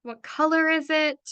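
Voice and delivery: usual speaking voice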